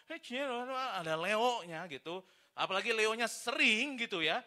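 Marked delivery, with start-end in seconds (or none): 0.1-1.8 slow, higher imitation of Bangka talk; mock Bangka chatter. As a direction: mimic Bangka mechanics chattering away. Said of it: "kalau mereka lagi ngomong".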